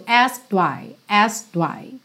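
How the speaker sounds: "asked why" run together, with no E; subtle asked why. Shown as a demonstration. A teacher repeating the subtle "asked why" for students. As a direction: blend "asked why" into one quick sound. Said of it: "Fica bem sutil".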